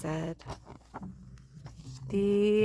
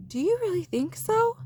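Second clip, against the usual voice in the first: eager little bear; 12 semitones higher than usual. Acted. Little Bear grins, unsure.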